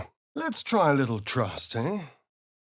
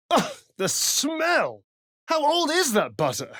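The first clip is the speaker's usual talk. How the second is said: disgusted; 13 semitones higher than usual. Acted. Disgust at a foul smell.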